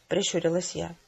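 plain reading voice

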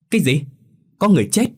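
high, loud shocked cry; family shouting in alarm. A narrator's reading. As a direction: shout it in disbelief. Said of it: "liền hét toáng cả lên".

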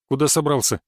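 plain reading voice

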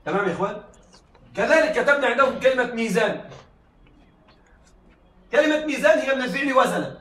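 ordinary delivery

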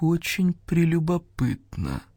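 slow, drawn-out musing voice; a slow, knowing vulture savouring each word; slow, mysterious old vulture voice. A narrator's reading. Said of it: "загадочно кивнул Гриф-стервятник".

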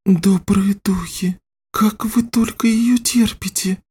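put-on slow, high, hushed girl's voice; girl's whisper. A narrator's reading. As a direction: whisper in pained wonder, high and girlish. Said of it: "прошептала девочка".

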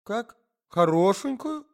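wife cutting in suspiciously; jealous wife. Acted. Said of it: "перебила жена".

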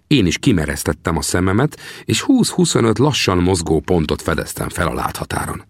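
usual speaking voice

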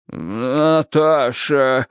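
very slow, drawn-out word; feeble, stretched mumble; dazed babbling. Acted. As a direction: let it drag out half-conscious. Dazed man babbles the name again.

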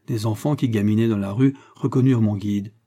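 usual speaking voice

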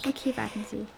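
whisper. Acted. Kind schreibt Diktat mit.

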